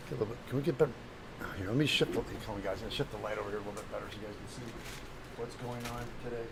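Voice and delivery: normal talking voice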